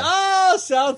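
loud high "oh"; overdone surprise; mock surprise. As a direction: shout the discovery in play. Exaggerated realization.

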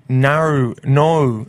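"Nar, no" with Australian drawn-out vowels. Shown as a demonstration. Struggling to show co-host the Australian "no" as "nar". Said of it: "I don't know how to say it's nar".

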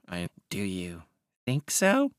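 odd little character voice; peculiar schemer. Acted. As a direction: ask it softly, then lift sharply in pitch. Wilde's character voice replies.

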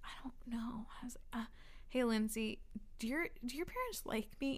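hushed, hesitant retold question; timid murmur. Recounting asking friend about her parents, timid murmur. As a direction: ask softly, half whispering.

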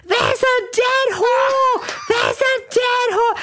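high, frantic yell, stretched out slowly; panicked shriek of a man who found a body; mock screaming. Bellboy screaming down the phone to emergency services, high panicked yell. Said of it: "he's screaming".

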